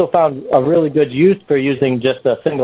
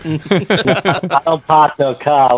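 mock Boston accent; much slower than usual. Joking about Boston accent.

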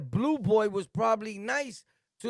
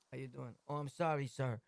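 meek polite greeting; 7 semitones lower, 11 dB quieter than usual. Retold or imitated. Acting extra polite greeting.

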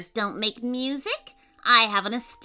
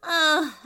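high, loud exasperated sigh; 7 semitones higher, 6 dB louder than usual. A narrator's reading. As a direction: heave an audible bat sigh. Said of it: "The bat sighed loudly".

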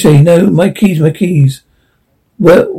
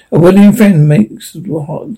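ordinary delivery

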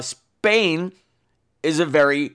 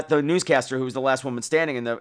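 ordinary talk

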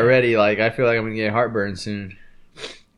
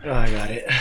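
ordinary delivery

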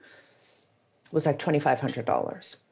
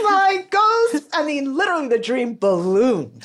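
high, bright burst of excitement; high excited rush; 9 semitones higher, 4 dB louder than usual. Real, not acted. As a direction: let the dream burst out, pitched up. Excited about growing dream.

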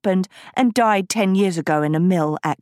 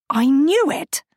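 flaring up; 9 semitones higher than usual. A narrator's reading. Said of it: "Agatha flared".

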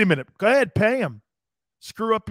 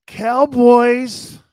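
loud call; 4 semitones higher, 11 dB louder than usual. Acted. Reacting to a rival team name.

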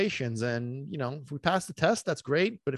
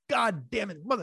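a mock-furious curse, pitched higher; half-hammed frustration at the screen; retold angry curse; 8 semitones higher than usual. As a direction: spit it out like the deal just slipped away again. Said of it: "And I was pissed".

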